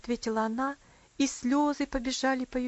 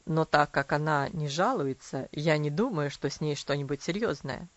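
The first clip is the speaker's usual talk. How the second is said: stern father; 7 semitones lower, 3 dB louder than usual. A narrator's reading. Father voiced in story.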